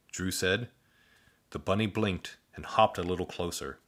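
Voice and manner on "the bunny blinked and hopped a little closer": plain reading voice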